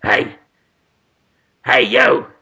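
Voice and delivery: rough put-on cat character; comic cat hailing its owner; talking cat. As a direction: call out as a brash cat. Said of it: "does the cat go".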